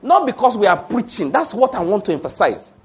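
fervent sermon voice, high and slow; preaching at full force. Acted. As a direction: preach it out, drawing each phrase long. Pastor preaching to congregation.

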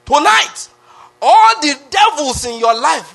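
high, loud and slowed proclamation; preaching shout. Real, not acted. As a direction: cry it out to the whole church, full force. Preacher rallies the congregation.

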